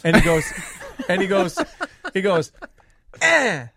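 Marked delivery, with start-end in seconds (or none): 3.2-3.7 high, louder bratty bleat; taunting "eh". Retold or imitated. Dad imitates son leaning in the door, taunting. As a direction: imitate a kid's defiant little noise. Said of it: "he does the verbal equivalent".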